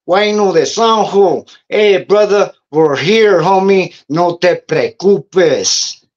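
loud, high called-out greeting, slowing at the end; shouting across the cell block, slow and loud; yelling. Retold or imitated. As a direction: call down the corridor to a brother. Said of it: "he's yelling".

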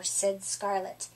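plain reading voice

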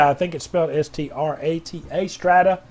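ordinary talk

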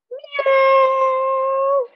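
high, loud, drawn-out meow; cat meow. Pretend. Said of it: "will be cat".

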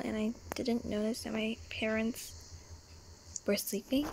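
ordinary talk, nothing marked

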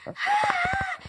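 high shrieking mock panic over the rain; imitating bus kids freaking out. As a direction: squeal like the rain will melt you. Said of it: "everyone on the bus flipped out".

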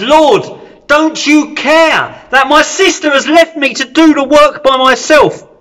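high, loud, indignant complaint; angry outburst. Acted. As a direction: let Martha's anger burst out at Jesus. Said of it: "she just blows up".

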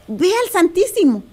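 ordinary talk, nothing marked

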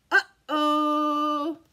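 loud drawn-out uh-oh; worried fish. Acted. Fish spotting the bird, drawn-out worried uh-oh. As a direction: voice a scared little fish. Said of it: "The fish goes".

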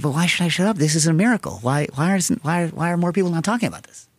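retold incredulous protest. Telling how they argued back to the guy, incredulous. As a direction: protest it, restarting as the whys pile up.

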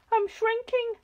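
husband panicking as he shrinks, high; burbling. A narrator's reading. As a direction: squeak it out in panic. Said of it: "burbled Mister Twit".

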